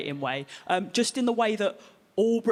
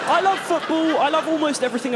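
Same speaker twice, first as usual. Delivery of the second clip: excited; 8 semitones higher, 6 dB louder than usual. Acted. Comedian opening loudly.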